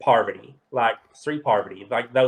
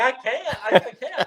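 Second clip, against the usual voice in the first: retold star-struck panic, pitched up and laughing; starstruck; 11 semitones higher than usual. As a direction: gush like a fan who can't cope. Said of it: "I'm like".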